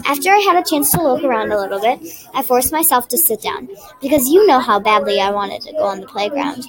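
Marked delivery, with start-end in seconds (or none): none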